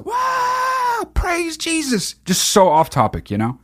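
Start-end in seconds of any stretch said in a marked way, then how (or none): 0.0-2.1 very high, drawn-out mock church cheer; softened falsetto crowd wail, stretched out; mock congregation. Churchgoers hearing the preacher, mock rapture. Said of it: "Everybody just go".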